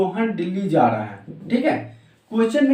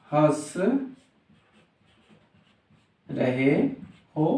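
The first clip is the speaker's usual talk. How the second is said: single words with very long gaps; very slow dictation; much slower than usual. Shown as a demonstration. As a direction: stretch each word as if writing it out. Writes the sentence while saying it. (बहुत धीरे, एक-एक शब्द रुक कर)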